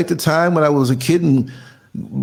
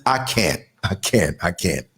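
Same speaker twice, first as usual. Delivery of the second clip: each I can't lower and softer; fading I can't; 13 semitones lower, 5 dB quieter than usual. Pretend. Quoting the show's title, fading to nothing. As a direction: let it sink into exhausted silence. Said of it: "like the title of the show says".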